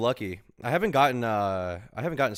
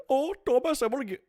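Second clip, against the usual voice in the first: put-on higher-pitched grandma in a Bulgarian accent. Grandmother comforting sick grandson, in a Bulgarian accent. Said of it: "how do I do a Bulgarian accent?".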